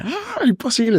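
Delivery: very high astonished cry; stunned disbelief. Retold or imitated. Prisoner hearing his bail is ready, very high and stunned. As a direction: gasp it out in disbelief. Client hears bail is paid.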